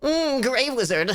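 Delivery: wizard voice. Pretend. Playing wizard character voices.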